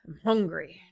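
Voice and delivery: hungry warrior, lightly growled; mild growl. A narrator's reading. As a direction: grumble it low and short. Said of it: "Joom growled".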